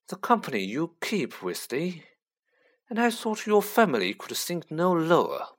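a hint of cold contempt, savouring the name; sneering Lucius Malfoy. A narrator's reading.

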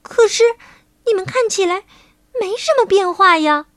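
high voice, baffled then exclaiming; puzzled princess. A narrator's reading. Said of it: "白雪公主纳闷儿地说".

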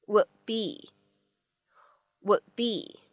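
"would be" with the D left unreleased; lost plosion. Shown as a demonstration. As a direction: swallow the D of "would" before "be". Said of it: "我们呢，可以读成是would be".

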